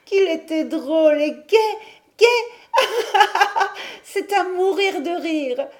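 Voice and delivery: high, loud cackling glee; chilling mad laughter. A narrator's reading. Mad sister amused by her vision, high chilling laughter. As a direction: burst into a madwoman's laugh and talk through it. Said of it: "Un rire a donné le frisson".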